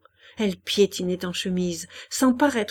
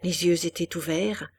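plain reading voice

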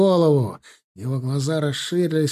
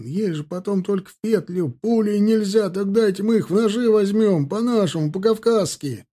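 heated plea; 7 semitones higher than usual. A narrator's reading. Soldier pleads to use knives.

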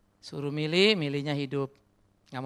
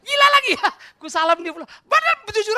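high squeal of laughter; real laughter at himself; laughing; 16 dB louder than usual. Real, not acted. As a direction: laugh through the words. Laughing at his own story.

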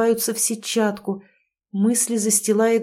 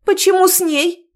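high shrill near-hysterical cry; slightly hysterical; 10 semitones higher, 6 dB louder than usual. Acted. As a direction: crack into mild hysteria. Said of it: "с лёгкой истерикой". (с лёгкой истерикой)

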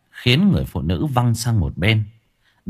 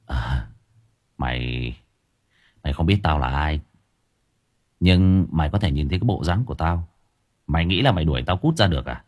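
low, quiet and unbothered threat; cool menace; 6 semitones lower, 4 dB quieter than usual. Acted. Hero quietly mocking the possessed husband, low menace. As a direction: talk down to him, softly dangerous.

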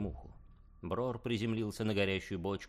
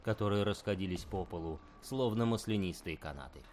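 ordinary delivery, nothing marked

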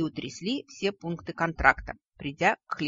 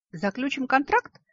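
плотоядная ухмылка; 9 semitones higher, 6 dB louder than usual. A narrator's reading. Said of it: "плотоядно ухмыльнулся наш бухгалтер".

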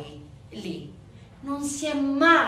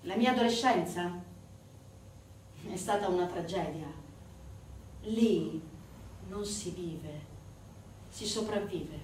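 sombre testimony; 3 semitones lower, 6 dB quieter than usual. Acted. Lea's own testimony, acted sombrely.

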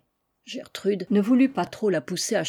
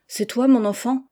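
usual speaking voice

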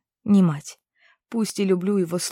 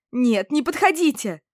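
high frightened shriek; squeal; 6 semitones higher, 4 dB louder than usual. A narrator's reading. Cornered girl shrieks at the approaching witches, high. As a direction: shriek them away from you. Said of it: "взвизгнула я".

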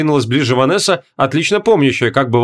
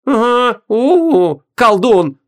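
high, triumphant gotcha; narrowed eyes; 9 semitones higher, much slower than usual. A narrator's reading. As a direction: pin him with it, eyes narrowed. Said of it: "сузила глаза Ванесса". (высоко, торжествующе, с прищуром)

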